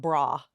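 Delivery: "bra" as one open syllable, brah; botched pronunciation. Shown as a demonstration. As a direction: say "bra" with a broad ah. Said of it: "mine kinda comes out".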